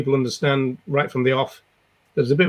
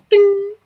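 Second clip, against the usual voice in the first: high ding; 5 dB louder than usual. Pretend. Jokey sound effect after yeehaw.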